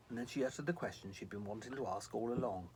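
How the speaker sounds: ordinary delivery, nothing marked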